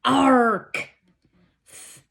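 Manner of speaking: spelling words growled like a pirate. Shown as a demonstration. Teacher voicing spelling words like a pirate. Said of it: "we have the pirate R's in each one of our words".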